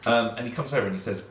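ordinary delivery

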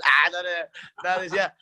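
ordinary delivery

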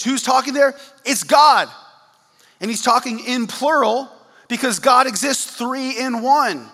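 emphatic preaching. Real, not acted. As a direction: drive it home to the congregation. Preaching at full force.

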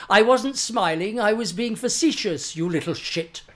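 loud, pointedly dry butler's put-down; a lightly voiced haughty manservant. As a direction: deliver the insult as a haughty valet would. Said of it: "I do a really good [name] auditioning for [name]".

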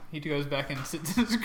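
ordinary delivery

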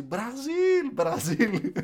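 high, loud mock chant of the country; imitating fan's shouting. Brazilian fan yelling while filming the player, imitated shouting. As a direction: mimic an excited fan yelling, first cry highest. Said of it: "να φωνάζει".

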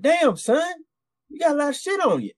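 very high-pitched mock sergeant; high, exclaiming Southern sergeant voice; Southern first sergeant.

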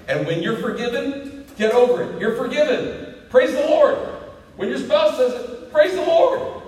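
high, loud exultant preaching; full-force preaching. Acted. Preacher urging the church to rejoice in forgiveness, fired-up preaching.

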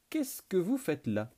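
high, slow challenge from behind; voix surprise. Acted. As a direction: catch them red-handed. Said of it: "furent surpris par une voix s'élevant dans leur dos". (voix haute et lente qui surprend)

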